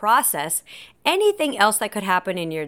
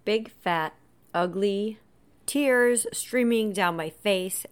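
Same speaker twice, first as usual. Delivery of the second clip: ordinary talk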